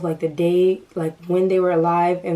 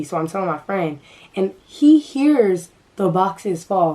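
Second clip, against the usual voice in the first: usual speaking voice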